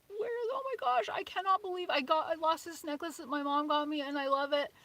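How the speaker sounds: high, frantic mock panic. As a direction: spiral into high-pitched panic.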